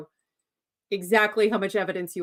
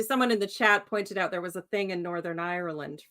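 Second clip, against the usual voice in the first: normal talking voice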